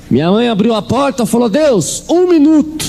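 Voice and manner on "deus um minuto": mother demanding God answer within a minute, high and urgent; high, insistent demand. Retold or imitated. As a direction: cry out the deadline.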